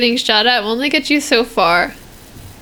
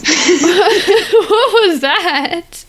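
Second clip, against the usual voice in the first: high, stretched out through laughter; laughing question; 6 semitones higher, much slower than usual. Real, not acted. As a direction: ask it while cracking up. Reacting to the strange laugh.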